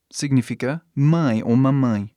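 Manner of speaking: usual speaking voice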